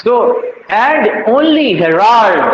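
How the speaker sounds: very slow, weight on only; slow verse recital. Shown as a demonstration. Teacher reciting the sonnet line to students, very slowly. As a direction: read the line out slowly, word by word.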